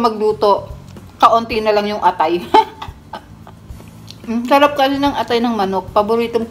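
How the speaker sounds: talking while chewing food; savoring food mid-bite; mouth full, chewing. Real, not acted. Seen 0: Bites liver at 0.2-0.5s, chews with eyes closed, then talks.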